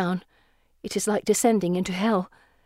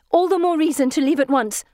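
higher-pitched urgent retort; 7 semitones higher, 3 dB louder than usual. A narrator's reading. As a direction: press them to hurry. Urgent reply while being chased.

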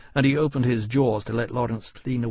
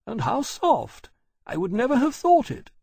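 very high voice, pleasantly surprised; delighted lady; 13 semitones higher, 4 dB louder than usual. Acted. Mother pats the dragon's hide, delightedly surprised. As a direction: marvel at it in a high lady's voice.

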